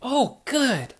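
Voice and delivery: put-on high, cheerful little girl piglet; Peppa Pig voice. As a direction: invite Daddy into the mud, high and bright. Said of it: "said Peppa".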